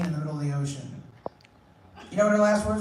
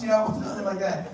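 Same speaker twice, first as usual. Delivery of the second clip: ordinary talk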